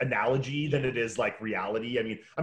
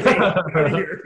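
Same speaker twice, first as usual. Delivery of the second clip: loud, raised mock shout; mock ordering out; 5 semitones higher, 10 dB louder than usual. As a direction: imitate someone throwing a person out. Imagined kicking her out, retold.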